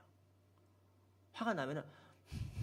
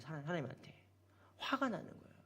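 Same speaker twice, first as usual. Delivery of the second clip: ordinary talk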